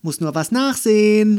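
high, loud reply; calling back. A narrator's reading. Girl answering her impatient father downstairs, calling back. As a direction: shout it back down the stairs. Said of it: "rief Andy zurück".

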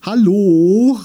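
high, loud, drawn-out call. A narrator's reading. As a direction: call over the rustling. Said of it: "rief sie gegen das Baumgeraschel an".

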